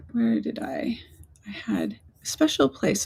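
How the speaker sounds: whispered search to herself; whispering. Real, not acted. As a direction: whisper while hunting for something. Muttering to herself while searching.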